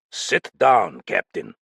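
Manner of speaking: sharp. Acted. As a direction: snap him back into his seat. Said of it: "Benkelin's voice became sharp".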